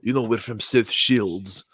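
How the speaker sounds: slow, put-on Geordie accent. Host showing his favorite accent, slow mock Geordie. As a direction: imitate a South Shields Geordie. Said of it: "like, the Geordie".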